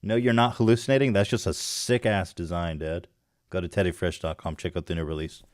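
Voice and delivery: normal talking voice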